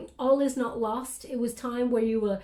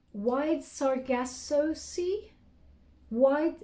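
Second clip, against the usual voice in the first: slow puzzled; 4 semitones higher, much slower than usual. Real, not acted. Puzzled at channeled book title.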